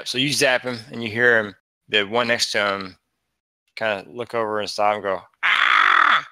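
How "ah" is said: high, loud goblin shriek; a goblin that spots the attack and screams; screaming goblin. Acted. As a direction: let out a sudden startled goblin yelp. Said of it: "Screaming goblin".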